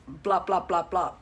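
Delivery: low, mocking blah-blah; imitating someone's pointless reasoning; dismissive mimicry. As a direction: drop the pitch and rattle off empty talk. Mimicking prejudiced reasoning as empty talk.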